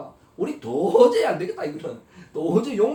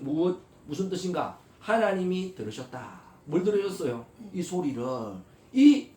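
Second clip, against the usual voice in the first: ordinary talk, nothing marked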